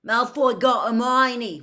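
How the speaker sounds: loud urgent accusation; loud protest. A narrator's reading. Ron pleading to Snape for Hermione, loud protest. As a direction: cry out the accusation.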